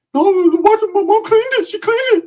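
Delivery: high squeaky voice for the cousin; squeaky high-voiced cousin calling. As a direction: pitch way up as the cousin. Cousin defends his backyard.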